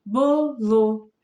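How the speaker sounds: "bolo" broken into a long "bo" and "lo"; split syllables. Shown as a demonstration. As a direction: sound out "bolo" slowly and loudly, bo... lo. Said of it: "a gente não lê bolo".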